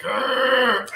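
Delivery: loud high frustrated argh; frustrated groan. Retold or imitated. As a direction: groan it out like he's cornered. Frustrated coworker reacts.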